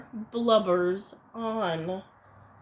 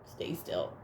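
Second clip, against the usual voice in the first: whisper; 10 dB quieter than usual. Acted. Narrator hushes listening children.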